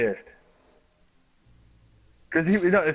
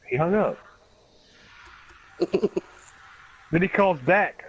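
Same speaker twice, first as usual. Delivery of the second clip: usual speaking voice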